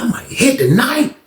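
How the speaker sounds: a hint of excitement on "hit"; eager musing. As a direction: let the hope bubble up. Musing to himself, two takes.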